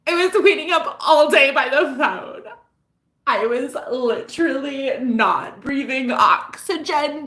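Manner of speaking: high, loud mock hysterics; hammed-up jilted girl. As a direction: wail it like a melodramatic movie girl. Hammed-up jilted caller, movie parody.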